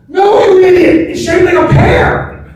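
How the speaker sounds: high, loud mock-outraged master; hammed-up yell of disbelief, slowing on the punchline; exasperated shout. Master philosopher berating his apprentice, exasperated shout.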